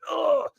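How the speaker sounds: high retold groan of frustration; frustrated groan. Cohost retelling how he lost a bid by one dollar, frustrated groan. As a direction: groan like a bidder who just lost out. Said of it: "I was like".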